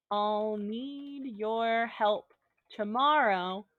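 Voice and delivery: drawn-out words, as if writing along; slow, measured dictation. Shown as a demonstration. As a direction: stretch each word for note-takers. Dictating slowly while writing it out.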